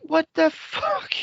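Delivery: high, drawn-out incredulous quote; baffled disbelief. Retold or imitated. Telling co-host about their reaction to the take, incredulous. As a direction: stretch it out high in mock disbelief.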